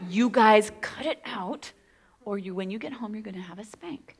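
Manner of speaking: put-on threatening hush to kids; stern whisper. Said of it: "and I'm, you know".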